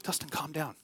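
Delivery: soft, gentle calming voice; calm reassurance. Retold or imitated. God gently telling an angry Dustin to calm down, soft and soothing. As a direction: soothe a worked-up friend. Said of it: "I heard him clearly".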